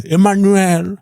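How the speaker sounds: slow, drawn-out mock of the name; teasing echo, stretched syllables; mocking imitation. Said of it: "il se fout de sa gueule".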